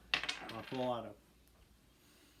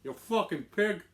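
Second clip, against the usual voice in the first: loud, higher Irish gangster insult; attempted Irish mob boss; 8 semitones higher, 8 dB louder than usual. Host rehearsing gangster insult, loud amateur Irish mob boss. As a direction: spit the insult as an Irish mobster. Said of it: "like, a, an Irish mob boss".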